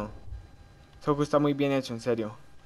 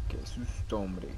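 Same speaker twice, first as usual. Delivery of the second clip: breathless whisper after a fright; whispered relief; 7 semitones lower, 12 dB quieter, slower than usual. Real, not acted. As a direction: let the fear out under your breath. Susurra tras el susto. (susurro aliviado)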